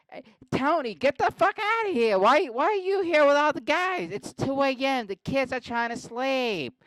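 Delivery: high, loud mock Boston wife; yelling Boston wife. Wife scolding husband about the late-night guys, high Boston yelling. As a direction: screech it like a fed-up Boston wife. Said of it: "his wife comes down and says".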